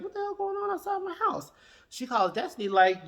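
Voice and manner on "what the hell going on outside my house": high, put-on voice of the startled sister; imitating the sister. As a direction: squeak it out like the sister at the window. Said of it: "Destiny's sister looks out and was like".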